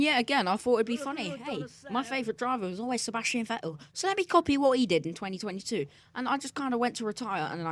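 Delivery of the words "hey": normal talking voice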